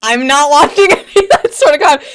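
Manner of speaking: high, loud and laughing; laughing yelp. Real, not acted. Caught off guard by a clip, loud laughing protest. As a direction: squeal it out through laughter.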